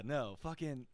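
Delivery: usual speaking voice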